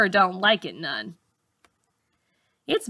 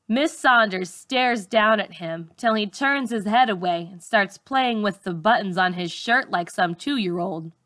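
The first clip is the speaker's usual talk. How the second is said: plain reading voice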